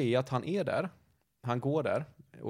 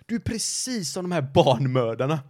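louder, slower mock outburst; furious accusation; 4 semitones higher, 7 dB louder than usual. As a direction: hurl the accusation like the raging neighbour. Neighbour's final accusation, loud.

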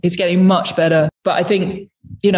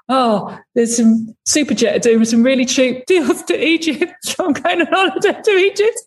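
higher mock accent, breaking into laughter; bad [name] accent; 7 semitones higher than usual. Woman imitating the singer explaining his holiday, bad accent. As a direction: badly imitate a musician's accent, amused and climbing in pitch. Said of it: "doing a really bad accent of you".

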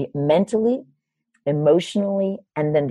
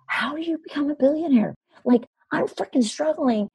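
high-pitched, exasperated plea; acted-out frustrated questioning; exasperated disbelief; 10 semitones higher than usual. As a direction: plead in baffled frustration, pitched well up. Retelling exasperated questioning of billionaire mentor.

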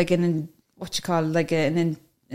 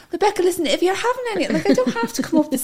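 much higher, rushed backing-out to the host; nervous excuses; 11 semitones higher than usual. Retold or imitated. Excusing herself from the podcast to Rebecca, higher and nervous. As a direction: plead your way out of it, pitch up high. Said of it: "And I'm like".

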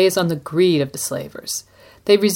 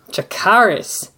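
commanding Dracarys; slower than usual. Acted. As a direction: call out Dracarys like a queen's order. Co-host echoes the dragon command.

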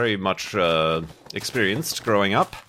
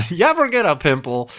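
high teasing voice; 8 semitones higher, 5 dB louder than usual. Acted. Playful repeated question.

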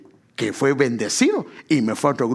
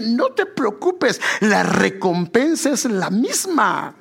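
fervent preaching; 6 semitones higher than usual. Real, not acted. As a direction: drive the reassurance home to the congregation. Preacher builds reassurance to a climax.

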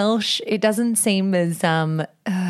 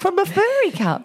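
puzzled question; 10 semitones higher than usual. Real, not acted.

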